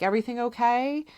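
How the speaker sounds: high and fast, anxious check; worried question. Retold or imitated. Mother asking the sitter if all is well, high and rushed. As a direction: rush out the question in worry.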